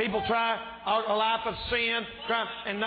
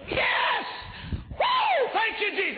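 screaming whoop far higher, then joyful thanks; ecstatic shout; slower than usual. Shown as a demonstration. Preacher showing the congregation how he'd celebrate, ecstatic whoop. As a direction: explode with joy like you just got a windfall. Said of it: "I'll show you what I do".

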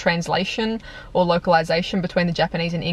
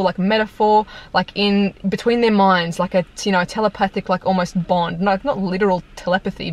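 ordinary delivery

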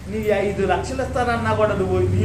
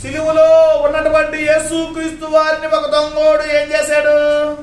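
loud, slow, higher preaching call; preaching shout; 7 semitones higher, 8 dB louder, slower than usual. Real, not acted. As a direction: drive the question home to the crowd. Preacher's climactic loud question.

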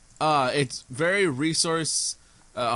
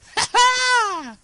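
wild laugh; 8 dB louder than usual. Real, not acted. Laughs at own joke.

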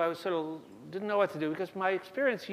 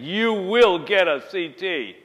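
louder, slow, booming order; loud demand; 3 semitones higher, 10 dB louder, slower than usual. Retold or imitated. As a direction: bark the order down a busy hallway. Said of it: "he's made a big stand".

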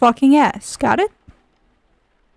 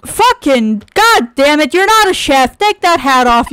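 angry yelling; 3 semitones higher, 4 dB louder than usual. Real, not acted. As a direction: blow up at him. Yelling at character wearing hat.

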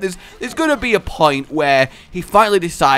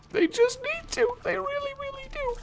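mock crying; 11 dB quieter than usual. Mock longing for a mod.

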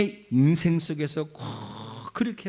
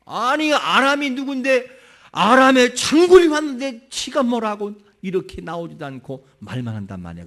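louder, higher indignant complaint; offended general; 6 semitones higher, 7 dB louder than usual. Retold or imitated. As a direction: huff like an insulted general. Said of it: "너무 기분 나빴던 거예요". (모욕당한 장군처럼 크게 불평하며)

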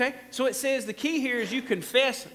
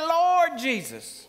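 slow drawn-out Lord Jesus, higher; call for response; 7 semitones higher, 3 dB louder, much slower than usual. Shown as a demonstration. Preacher prompting the congregation to repeat, slow and drawn out. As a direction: lead the church in saying Lord Jesus slowly. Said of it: "Somebody say".

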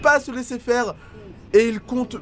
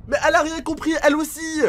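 normal talking voice

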